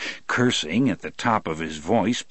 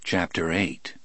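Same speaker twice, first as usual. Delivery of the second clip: ordinary delivery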